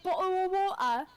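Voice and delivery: hammed-up British with dropped consonants; mock the British way of talking; British accent. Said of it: "British people be like".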